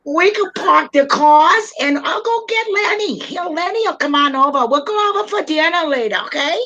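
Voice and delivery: high, hammed-up Bronx accent; put-on high Bronx voice; New York Bronx accent. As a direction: chatter away in broad New York. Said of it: "don't you like my New York Bronx accent".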